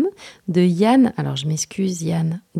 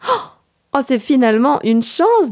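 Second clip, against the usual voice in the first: higher, lightly acted delight at treats; greedy relish; 6 semitones higher, 7 dB louder than usual. Boy offered chocolate and biscuits, mild greedy delight. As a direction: savour it with a touch of greed. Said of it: "dit le garçon avec gourmandise".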